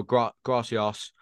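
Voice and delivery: "gracias" said slowly with a stutter; stumbling, careful gracias; slow drawn-out gracias. Shown as a demonstration. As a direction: stretch out "Gra-, gracias" awkwardly. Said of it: "It's all in the delivery with you".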